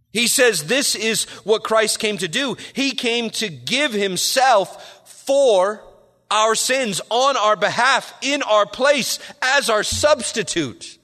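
impassioned sermon climax; fervent preaching. Acted. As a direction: drive the point home from the pulpit.

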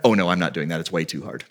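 a touch of a careless hypocrite; lazy excuse. Retold or imitated. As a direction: shrug it off casually.

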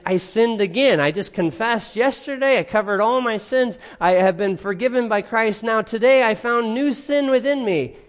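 anxious believer. Retold or imitated. Voicing a troubled believer's confession.

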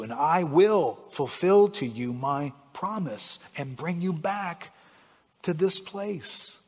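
slow, deliberate scripture reading. Acted. Preacher reading God's promise to the congregation, slow and deliberate. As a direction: read God's promise slowly, with long pauses. Reads the verse aloud.

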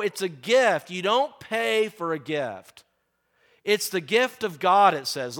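normal talking voice

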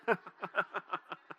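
short run of chuckles; laugh. Real, not acted. Preacher joking with congregation about his message, chuckling. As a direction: chuckle at your own joke.

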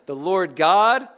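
slow, loud "Lord God", lifting on "God"; emphasis on the name. Shown as a demonstration. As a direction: drive "God" home with a rise. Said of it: "What's the emphasis?".

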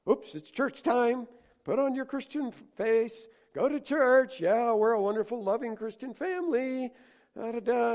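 high, loud sing-song parody of a fake-happy churchgoer; mock hypocritical cheer. Preacher mocking a hypocritical family's fake church cheer.

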